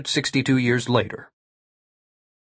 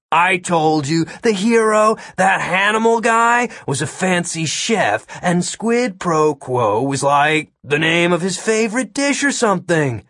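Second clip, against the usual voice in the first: put-on high, know-it-all kid voice; mocking; 6 semitones higher than usual. A narrator's reading. Kid explaining a movie to the agent, mocking. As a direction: explain as if to an idiot, pitched up. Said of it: "He laughed at how stupid Agent Miller was being".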